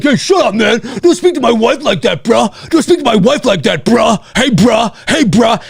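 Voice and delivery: lightly voiced confrontational bro; mock macho husband, insistent; defensive husband. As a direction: act out squaring up for your wife. Acts out defensive husband confronting him.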